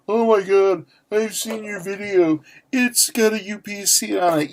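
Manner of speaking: mock an accusing viewer's gotcha; high, far-off mock fan voice with a touch of gloating; imagined viewer. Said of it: "you're probably thinking".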